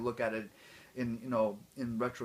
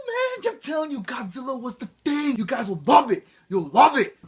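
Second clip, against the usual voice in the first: loud, high, hyped shout; 12 semitones higher, 12 dB louder than usual. Pretend. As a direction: pump the crowd up, loud and high. Hyped outro bragging about Godzilla.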